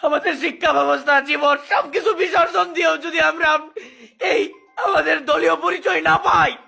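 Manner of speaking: wailing through tears; desperate sobbing lament; snotty crying. Real, not acted. Crying while complaining.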